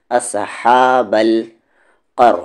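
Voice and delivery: اصحاب القر drawn out slowly with a heavy ق and ر; slow recitation. Shown as a demonstration. Teacher reciting slowly so pupils hear the heavy letters. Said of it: "قاف heavy letters میں سے ہے".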